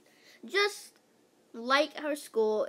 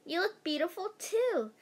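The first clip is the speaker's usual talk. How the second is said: put-on higher sweet girl voice; girly compliment; 6 semitones higher than usual. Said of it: "said Andrea".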